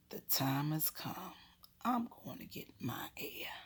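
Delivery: hushed, solemn declaration; hushed announcement. Retold or imitated. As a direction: announce it softly, like a secret plan. Child's solemn secret announcement.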